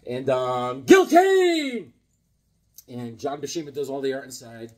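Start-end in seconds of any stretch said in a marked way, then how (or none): 0.8-1.9 high, loud, stretched-out cheer; hollered name. Pretend. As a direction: hail the artist like a hype man. Exclaims the artist's name.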